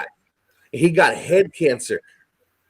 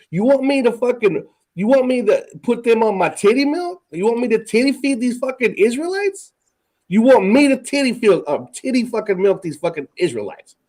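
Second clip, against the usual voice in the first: higher-pitched, incredulous mock protest; Moses being sarcastic; 4 semitones higher than usual. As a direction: throw it back at God as indignant sarcastic questions. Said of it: "saying in a sarcastic way".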